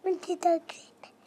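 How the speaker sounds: tiny whispered echo. Real, not acted. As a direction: whisper it back. Child whispers back the promise.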